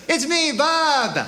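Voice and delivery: high, drawn-out comic announcement; unmasked prankster reveal. Acted. Devil pulls off his mask to the killer, high gleeful reveal. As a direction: sing out the big reveal, stretched long. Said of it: "the devil pulls off a mask".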